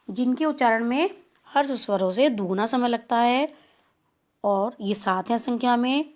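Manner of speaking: ordinary talk, nothing marked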